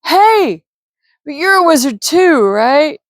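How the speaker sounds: high, loud call after him; yelled. A narrator's reading. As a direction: shout to stop him at the door. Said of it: "he yelled".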